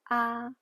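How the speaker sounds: "ah" held on a high flat pitch; first tone. Shown as a demonstration. Teacher demonstrating Chinese first tone, high flat pitch. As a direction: say "ah" high, level and strong. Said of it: "The first tone is a high flat pitch".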